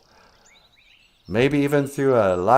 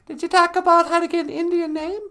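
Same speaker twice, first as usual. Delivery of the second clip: very high squeaky puppet wife greeting. Acted. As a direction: chirp it in a tiny high voice.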